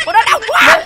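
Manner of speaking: high-pitched half-laughing ouch; game-hurt squeal with a laugh; pained yelp. Pretend. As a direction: yelp in playful pain.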